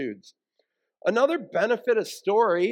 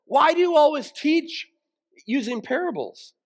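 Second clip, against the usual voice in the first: questioning disciples; 7 semitones higher, 4 dB louder than usual. Retold or imitated. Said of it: "his disciples said".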